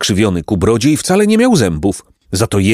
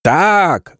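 bleating; 8 semitones higher than usual. Acted. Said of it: "zabeczała koza".

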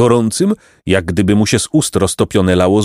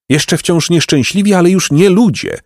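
usual speaking voice

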